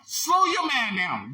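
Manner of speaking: normal talking voice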